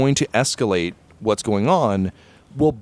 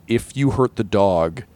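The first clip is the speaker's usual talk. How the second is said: slow, measured mock menace; deliberate threat; 4 semitones lower, slower than usual. Friend warning game master about the dog, slow threat. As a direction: deliver the warning slowly, spacing it out.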